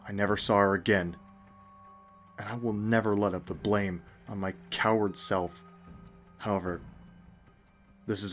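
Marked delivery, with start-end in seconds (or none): none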